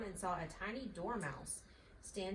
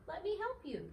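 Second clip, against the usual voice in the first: kind little mouse; 10 semitones higher than usual. A narrator's reading. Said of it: "said Dinky".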